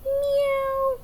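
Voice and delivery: high meow; kids' teacher meowing; cat meow. Shown as a demonstration. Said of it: "Let me hear you".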